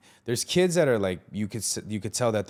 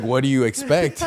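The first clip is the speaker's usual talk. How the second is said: loud, higher-pitched amused retort; exclaimed retort; 4 semitones higher, 7 dB louder than usual. Retold or imitated. Pastor John answering the complaint about a gang member, exclaimed retort. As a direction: imitate a pastor's incredulous comeback, loudly.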